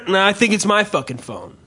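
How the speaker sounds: ordinary talk, nothing marked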